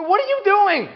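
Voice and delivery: high, loud mock panic; panicked passengers. Passengers seeing the attendant freak out, high loud mock panic. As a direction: cry out in alarm. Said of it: "people are going".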